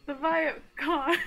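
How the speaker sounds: panicked high cry, half laughing; screaming in fear. Acted. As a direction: wail in terror at them. Panicked character, loud high.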